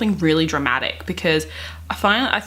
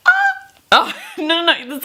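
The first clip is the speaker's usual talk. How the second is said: laughing dismay; 11 semitones higher, 4 dB louder than usual. Real, not acted. Reacting to imprinting plot.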